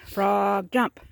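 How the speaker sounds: loud command with a long drawn-out frog; acted yell. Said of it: "So he yelled at the frog".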